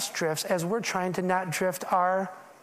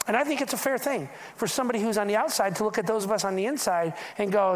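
normal talking voice

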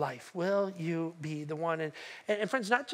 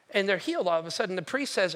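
ordinary talk

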